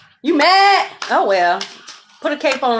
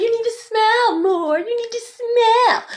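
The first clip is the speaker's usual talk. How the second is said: high singsong imitation of unwanted advice; mocking mimicry; 10 semitones higher than usual. Mimicking those who told her.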